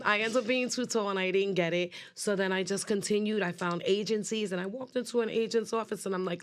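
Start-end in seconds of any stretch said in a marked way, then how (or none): none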